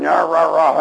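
mock yapping "rah, rah, rah"; devil's accusing noise. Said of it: "devil's still standing before you going".

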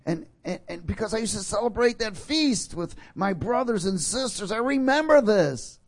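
preacher voicing the psalmist's longing; rising preaching. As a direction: climb in pitch as the memory swells. Preaching builds in force.

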